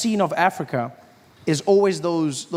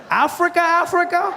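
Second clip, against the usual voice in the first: mock disbelief; 12 semitones higher than usual. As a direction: pitch it up in shock. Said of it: "He's like".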